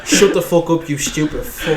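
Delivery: a lightly voiced Ryan, blunt and laughing; Ryan's reply. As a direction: snap back as Ryan, barely voiced. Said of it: "and then Ryan's just like".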